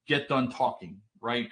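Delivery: usual speaking voice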